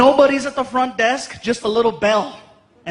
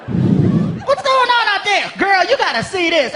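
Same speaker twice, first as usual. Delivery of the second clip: sassy clerk calling out; 8 semitones higher than usual. Acted. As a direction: holler to the coworker in back. Clerk calling coworker, high.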